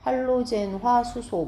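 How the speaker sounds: slow, drawn-out 할로젠화 수소; reading demonstration. Shown as a demonstration. Teacher showing class how to read the compound name, slow demonstration. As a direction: read out 할로젠화 수소 slowly, stretching the syllables. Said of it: "이렇게 읽어야죠".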